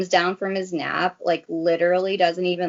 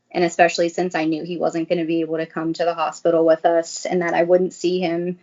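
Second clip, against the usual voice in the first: ordinary talk, nothing marked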